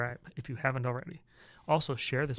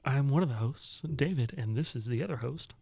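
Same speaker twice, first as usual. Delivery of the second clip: podcaster voice. Pretend. Host welcoming listeners to the show, podcaster voice. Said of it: "You like my podcaster voice?".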